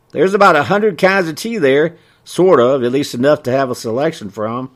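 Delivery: high, quick country storekeeper; chatty small-town merchant; folksy shopkeeper voice. Acted. Ray voiced.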